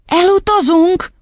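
put-on very high, drawn-out child's question; little girl's astonished outcry; shocked cry. A narrator's reading. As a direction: cry it out in disbelief. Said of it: "kiáltott Matilda".

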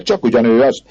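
high, excited identification; crowd answering. A narrator's reading. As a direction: call it out for the whole crowd. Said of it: "felelték a többiek".

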